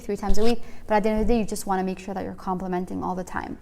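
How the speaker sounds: usual speaking voice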